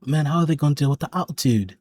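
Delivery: a deliberately bad English accent, lightly put on; cheesy fake English accent; mock English accent. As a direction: do a terrible impression of an English pundit. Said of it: "terrible English accent".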